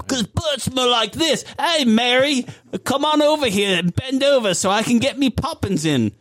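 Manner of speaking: high-pitched mock Cockney; Bert. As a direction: ham it up as a leering sweep. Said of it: "not Bert".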